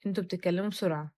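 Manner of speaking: normal talking voice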